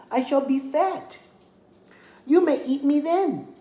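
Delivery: high, pleading little lamb voice; a small lamb talking her way out of danger. Acted. Lamb pleads with the hungry coyote, high and sweet.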